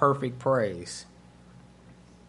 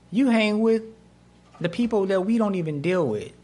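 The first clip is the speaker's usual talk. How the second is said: higher-pitched, affronted protest; indignant; 6 semitones higher than usual. Acted. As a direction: object like an offended religious leader. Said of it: "the tone in their voice".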